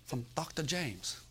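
put-on caricatured Korean-accented English; imitated Korean accent. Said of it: "South Korean man".

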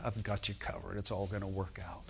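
low, hushed comfort; quiet, deep assurance; reassuring Holy Ghost. Acted. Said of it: "the Holy Ghost said".

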